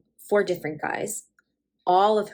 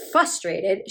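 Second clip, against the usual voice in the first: ordinary delivery, nothing marked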